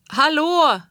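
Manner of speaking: high loud call across the snow. A narrator's reading. Lost child calls into the blizzard, loud high call. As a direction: shout hallo into the storm. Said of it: "ropade jag".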